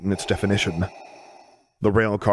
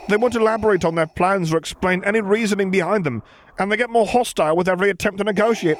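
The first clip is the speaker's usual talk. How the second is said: higher, anxious junior officer; nervous officer voice; 12 semitones higher than usual. Acted. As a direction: answer like a jumpy subordinate. Officer reports in role.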